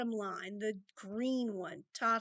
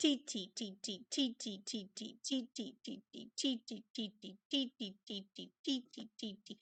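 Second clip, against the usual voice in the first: much softer clipped T beats; soft rhythm syllables; 3 semitones lower, 7 dB quieter than usual. Shown as a demonstration. Teacher demonstrating the unaccented beats to the class, soft ticking T. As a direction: tap out the weak beats under your breath. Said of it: "and you repeat".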